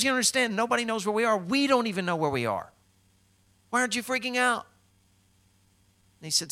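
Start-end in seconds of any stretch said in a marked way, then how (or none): 0.0-4.7 high, loud exasperated panic; freaking out. Acted. Castaway challenging his calm companion, freaking out. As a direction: act out a castaway at his wits' end. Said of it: "The other dude was freaking out".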